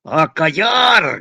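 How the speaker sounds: slow, loud, drawn-out command; stamping shout. A narrator's reading. Father stamping his feet and roaring at his son, slow and loud. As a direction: stamp and roar it out. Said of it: "gritó el padre pataleando".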